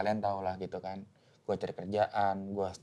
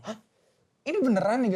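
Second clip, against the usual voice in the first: high, loud gasp of disbelief; can't believe the good news; shocked disbelief; 12 semitones higher, 6 dB louder than usual. Retold or imitated. Job seeker to God, shocked high disbelief. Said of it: "tiba-tiba dapet kayak".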